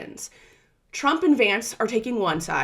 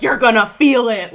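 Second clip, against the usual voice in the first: much louder mock threat; imitated warning; 2 semitones higher, 10 dB louder than usual. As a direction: hit it like a warning shot. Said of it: "Zelensky's like".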